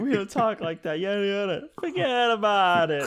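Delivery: high mock quarrel from a video; hammed-up high New York bickering; imitated Karen and New Yorker. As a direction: replay the scolding, laughing, pitched high. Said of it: "She's like".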